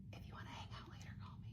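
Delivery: quick, very soft whisper; hushed, hurried mock seduction; flirty whisper. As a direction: whisper it like a secret come-on. Acts out flirty offer to singer.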